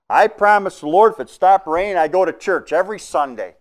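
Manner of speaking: high, loud promise to God; earnest vow. Retold or imitated. Trucker's wife promising the Lord in the cab, loud high vow. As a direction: swear it with everything you have. Wife vows if rain stops.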